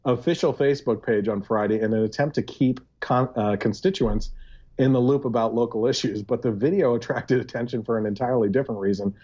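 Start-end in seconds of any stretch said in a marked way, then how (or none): none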